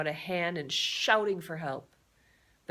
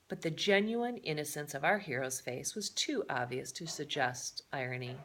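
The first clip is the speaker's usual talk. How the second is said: plain reading voice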